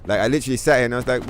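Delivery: ordinary talk, nothing marked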